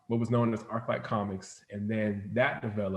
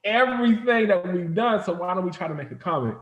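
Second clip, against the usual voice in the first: higher and louder, eager pitch of a new plan; lively retold brainstorm, pitched up and loud; excited retold idea; 9 semitones higher, 6 dB louder than usual. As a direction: sell the idea with a rush of excitement. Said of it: "we were like".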